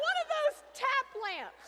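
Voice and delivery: mother-in-law chiming in cheerfully; chirpy mother-in-law. Retold or imitated. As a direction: pitch it up brightly like a happy idea. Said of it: "And she goes".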